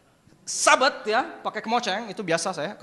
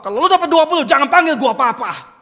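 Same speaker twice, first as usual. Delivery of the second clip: louder, higher mock-angry dad; hammed-up parental telling-off; scolding father; 4 semitones higher, 6 dB louder than usual. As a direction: disown the kid in playful outrage. Voices a father disowning his child.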